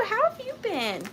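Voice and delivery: high, bright hello to a regular; delighted welcome back; excited greeting. Real, not acted. Greeting returning viewer.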